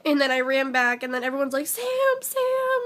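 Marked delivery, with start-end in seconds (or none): 1.7-2.8 high-pitched mock call, voice lifted; imitated calling out. Everyone calls her back to her seat, high imitated calling. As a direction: imitate friends calling out a name.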